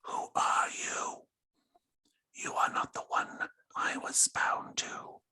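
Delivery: tiny, slow, whispered creature voice. Acted. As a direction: whisper it slowly like something small and eerie. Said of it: "in a tiny voice".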